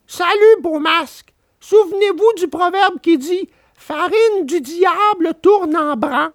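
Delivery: high puppet voice, cheeky farewell; Pinocchio mocking. A narrator's reading. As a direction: brush off the swindlers. Pinocchio lectures them.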